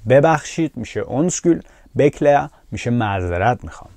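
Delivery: normal talking voice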